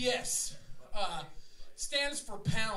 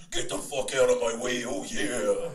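much lower, gravelly-free growl of a wrestler; Macho Man impression; 12 semitones lower, 4 dB louder than usual. Retelling Macho Man stepping on his shoe, low growl.

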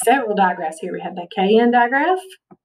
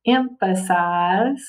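"emphasize" drawn out slowly, part by part; broken into syllables; much slower than usual. Shown as a demonstration. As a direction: break "emphasize" up slowly to pronounce it. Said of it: "have to break up just a little bit".

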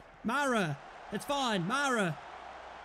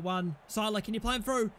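ordinary delivery

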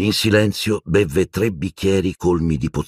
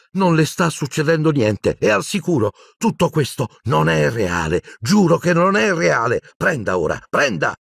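frantic coaxing; 11 semitones higher than usual. A narrator's reading. Harry coaxing terrified Dumbledore.

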